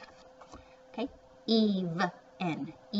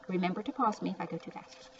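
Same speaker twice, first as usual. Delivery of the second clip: ordinary talk, nothing marked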